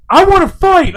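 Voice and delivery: loud, high mock challenge; retold fighting challenge. Wife drunk at the [name] concert, loud fighting challenge. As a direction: imitate her rowdy call for a fight.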